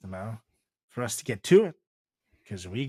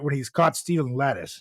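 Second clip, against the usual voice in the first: ordinary delivery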